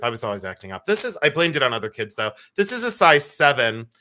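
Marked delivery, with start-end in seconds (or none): 0.0-0.8 quiet confession. Shown as a demonstration. Admitting to viewers she misbehaved in school, quiet. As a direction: lower and softer, owning up. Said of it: "I'll tell you the truth".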